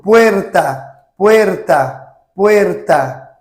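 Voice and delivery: puerta with a tapped R; puerta, r tocado; Spanish puerta. Shown as a demonstration. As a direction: say puerta with a light flap on the r. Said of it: "Por exemplo".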